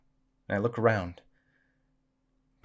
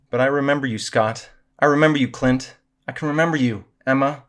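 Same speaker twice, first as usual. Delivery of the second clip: raised, forceful, building declaration; loud declaration; 5 semitones higher, 9 dB louder than usual. A narrator's reading. Samantha calls to lost friends in the void, loud declaration. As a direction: call each friend out with conviction.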